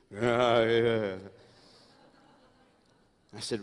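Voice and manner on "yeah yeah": imitating Ron. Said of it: "he just makes noises".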